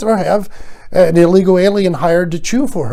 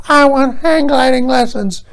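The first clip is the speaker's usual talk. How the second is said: mock old grandma; 7 semitones higher than usual. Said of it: "suffering from senility".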